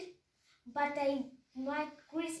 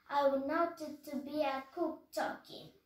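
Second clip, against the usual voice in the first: ordinary delivery, nothing marked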